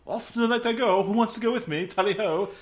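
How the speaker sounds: high, loud, hammed-up English tally-ho voice; posh British adventurer. Peter announces he's off into the night, hammed-up posh British. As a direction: play the jolly Englishman heading out. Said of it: "so he's like".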